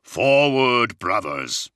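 rally the troops forward; battle cry. Acted. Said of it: "he cried".

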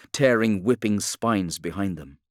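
ordinary delivery, nothing marked

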